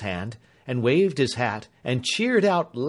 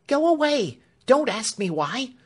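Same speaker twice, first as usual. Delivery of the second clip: much higher and louder, panicked servant voice; frantic warning; 6 dB louder than usual. A narrator's reading. Clemency urgently sends Alfred away, frantic and afraid. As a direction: drive him off in fear. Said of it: "she answered, pushing him back".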